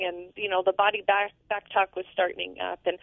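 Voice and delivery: ordinary talk, nothing marked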